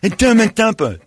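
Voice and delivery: put-on high, loud kid voice; hammed-up deaf kid; deaf kid impression. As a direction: mimic the half-deaf boy. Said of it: "That's a really good impression".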